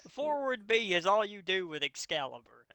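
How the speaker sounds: can't believe anyone would lose with this; exasperated disbelief. Real, not acted. Mocking the fic's weak heroes.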